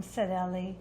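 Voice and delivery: plain reading voice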